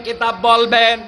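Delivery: ordinary talk, nothing marked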